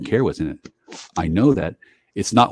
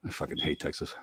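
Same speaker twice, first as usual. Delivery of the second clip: whispered; 9 semitones lower, 19 dB quieter than usual. Acted. Troll whispered.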